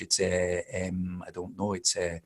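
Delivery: soft Scottish "eh, em" thinking sounds; Scottish hesitation. Shown as a demonstration. Accent coach showing Scottish thinking sounds, soft Scottish hesitation. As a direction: hesitate quietly like a Scot, drawing out the fillers. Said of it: "if you're Scottish".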